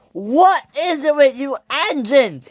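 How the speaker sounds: slow, high, loud outburst; angry scream. A narrator's reading. Percy at the logging locos, angry scream. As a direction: yell it, stretched with fury. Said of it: "he screamed".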